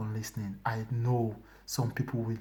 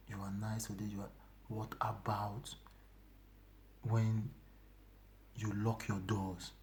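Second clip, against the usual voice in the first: divine voice; 5 semitones lower, 7 dB quieter than usual. Retold or imitated. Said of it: "He said".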